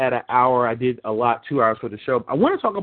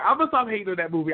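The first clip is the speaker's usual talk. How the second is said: fast, breezy promise; quick promise; 4 semitones higher, faster than usual. Shown as a demonstration. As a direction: rattle off the promise lightly.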